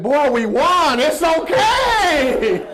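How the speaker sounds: high, loud, slow laughing shout; laughing reassurance. Retold or imitated. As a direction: reassure the boy while laughing. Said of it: "He said".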